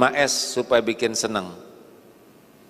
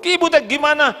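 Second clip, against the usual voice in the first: much higher, louder snapping question; angry spouse; 5 dB louder, faster than usual. Pretend. Husband scolds his sulking wife, loud and high. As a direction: snap at your wife.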